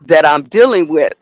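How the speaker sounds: normal talking voice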